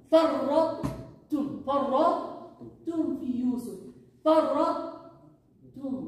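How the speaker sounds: فرطتم drawn out slowly, syllable by syllable; فرطتم recited slowly and deliberately; slow recitation demo. Shown as a demonstration. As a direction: stretch فرطتم slowly for the student. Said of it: "You jump from the huge فرطتم".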